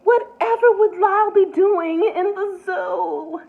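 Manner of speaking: high, distressed plea; miserable exclamation. A narrator's reading. Mrs Prim dismayed at the zoo papers, miserable. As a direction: cry out in dismay. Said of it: "exclaimed miserably".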